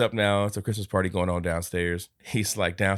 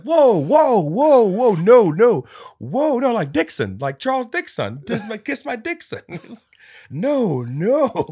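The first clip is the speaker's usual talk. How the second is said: much higher, louder flustered voice; high mock panic; 14 semitones higher, 8 dB louder, slower than usual. [name] stopping Scrooge's insult, high flustered mock voice. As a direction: frantically backpedal in a silly voice.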